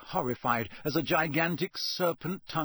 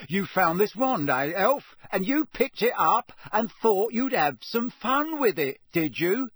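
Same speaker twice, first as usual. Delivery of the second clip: raised, louder taunting interrogation; hard accusation; 8 semitones higher, 5 dB louder than usual. A narrator's reading. Official cornering the elf, hard and accusing. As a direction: needle the elf into confessing. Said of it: "his eyes hardening".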